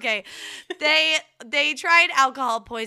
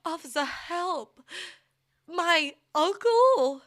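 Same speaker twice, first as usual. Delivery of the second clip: Christopher Walken impression; 7 semitones higher, slower than usual. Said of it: "That's like Christopher Walken".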